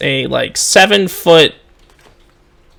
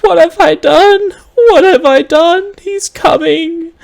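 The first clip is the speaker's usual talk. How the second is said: loud weeping; 6 dB louder than usual. Acted. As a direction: sob it out loudly in anguish. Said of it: "is weeping loudly".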